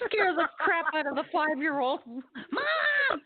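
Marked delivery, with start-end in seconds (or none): 2.0-3.2 scream. Retold or imitated. Kid screaming for mom, mock scare.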